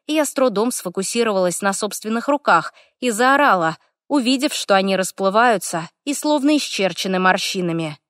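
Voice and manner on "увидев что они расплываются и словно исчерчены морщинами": plain reading voice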